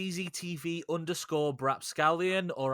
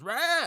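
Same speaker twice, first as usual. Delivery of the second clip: short high battle roar; mock battle roar; 12 semitones higher than usual. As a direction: roar as you swing the sword. Cutting you in half war cry.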